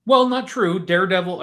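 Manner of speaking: normal talking voice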